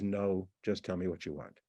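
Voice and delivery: low, quiet, cold reply. Acted. Boyfriend texting girlfriend about her Christmas present, cold. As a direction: answer coldly, low and without any softening. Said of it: "Sounds very cold".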